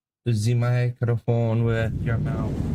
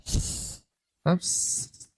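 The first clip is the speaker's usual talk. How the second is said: long hissing sss; drawn-out s like a snake; 9 semitones higher, 5 dB quieter than usual. Retold or imitated. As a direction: hiss the s like a snake. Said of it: "you sound like a snake".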